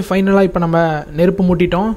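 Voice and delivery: ordinary talk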